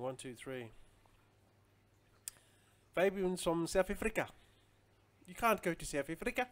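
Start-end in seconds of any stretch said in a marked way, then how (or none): 2.9-6.5 higher, louder mock South African accent; bad South African accent. Streamer teasing viewers about South Africa, in a badly faked South African accent. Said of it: "that's my really bad South African voice".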